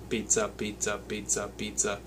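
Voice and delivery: even, flat-timed robot pizza, soft. Shown as a demonstration. Teacher demoing straight feel as a robot. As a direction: say "pizza" like a robot, every beat equal and straight. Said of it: "straight feel is if you were a robot saying the word".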